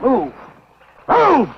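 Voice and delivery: high, loud yelled command; furious shout. Acted. Husband driving Cal and wife out, furious shout. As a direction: bellow the order, building.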